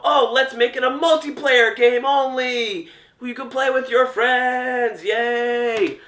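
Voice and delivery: high, silly made-up idea voice; mock dumb developer. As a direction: play a clueless happy dev. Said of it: "and they were like".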